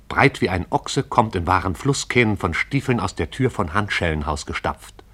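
usual speaking voice

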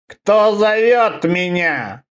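hoarse, rattling, slow and commanding. Acted. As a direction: rasp it out like a summoned thing. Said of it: "голос этот был хриплый и дребезжащим".

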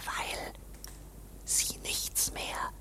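voiceless hushed whisper; sly whisper. Acted. Clerk whispers that K should kill the student. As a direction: breathe the suggestion, hidden.

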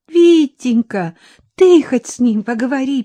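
put-on pleading old woman. A narrator's reading. Granny begs Viktor to reason with him, pleading. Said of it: "умоляюще проговорила бабушка Роза".

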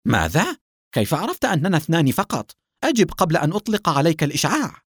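high, incredulous questioning, turning demanding; full of astonishment. A narrator's reading. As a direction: demand an answer in amazement. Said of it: "بصوت ملؤه العجب". (تعجّب شديد)

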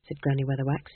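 ordinary delivery, nothing marked

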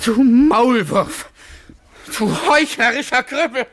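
furious tearful outburst; angry crying. Acted. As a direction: scream it out through angry tears. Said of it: "Nagel weinte vor Zorn und Beschämung". (wütendes Weinen)

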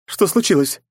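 alarmed. Acted. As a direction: ask it in sudden worry. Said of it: "встревоженно воскликнула Найт".

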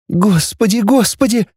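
high-pitched, distressed wail; lamenting. A narrator's reading. Girl hears the parents were killed, lamenting. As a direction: lament it, climbing on the repeat. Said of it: "запричитала Наташа".

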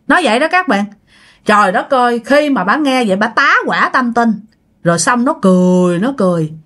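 plain reading voice

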